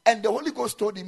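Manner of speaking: preacher extolling holy founders; exalted preaching. Real, not acted. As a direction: lift the voice in awe of them. Preaching at full force.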